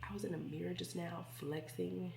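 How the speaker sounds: quiet hushed voice. Real, not acted. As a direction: keep it hushed. Hushed all through.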